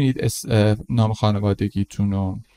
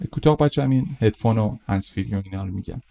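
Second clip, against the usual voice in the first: ordinary talk, nothing marked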